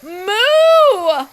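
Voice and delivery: high, loud mock moo; a long drawn-out moo; cow moo. A narrator's reading. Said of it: "said Cow".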